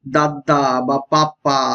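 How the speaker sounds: put-on slow, drawn-out baby babble; babbling demo. Shown as a demonstration. Teacher slowly models a baby's first babbling sounds. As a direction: stretch each syllable like an infant trying words. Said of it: "এই সময় শিশু".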